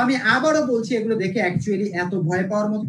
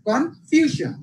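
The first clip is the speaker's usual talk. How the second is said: Confusion split, ফিউ high and then falling; stress before shon; 6 semitones higher, much slower than usual. Shown as a demonstration. Teacher repeating for students, confusion stressed on ফিউ. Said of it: "শন এর আগের যে সিলেবলটা".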